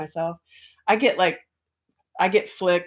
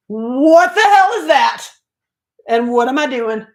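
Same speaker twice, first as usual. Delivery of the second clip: loud, high mock-outraged question; hammed-up baffled outburst; bewildered yell; 6 semitones higher, 8 dB louder than usual. Retelling her alarmed reply to beings.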